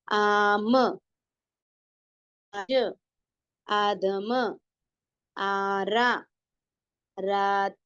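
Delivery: each word apart with long held vowels: आम, आज, आदम, आरा, रात; careful model reading. Shown as a demonstration. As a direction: draw out आम through रात sound by sound. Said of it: "पूरा lesson read करेंगे".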